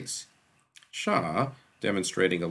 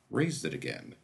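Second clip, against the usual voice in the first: usual speaking voice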